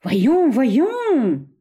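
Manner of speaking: high, drawn-out remonstrance; trying to calm them. A narrator's reading. De Cheneviette to the brawling men, insistently calming. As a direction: call for calm over the quarrel. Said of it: "essayant de les calmer".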